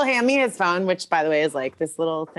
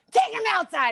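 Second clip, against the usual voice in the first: high-pitched shouted command; retold yell, pitched way up; mock order; 13 semitones higher than usual. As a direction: call out as if to a bouncer. Retells calling for him to be removed.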